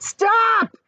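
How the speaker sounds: high, loud panicked cry; cried out. A narrator's reading. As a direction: shriek to halt him. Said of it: "cried the earthworm".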